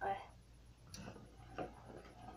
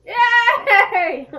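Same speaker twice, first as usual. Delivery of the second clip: excited cheer; 10 semitones higher, 11 dB louder than usual. Real, not acted. Celebrating the coconut.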